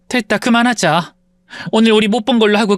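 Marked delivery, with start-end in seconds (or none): none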